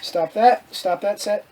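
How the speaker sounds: ordinary talk, nothing marked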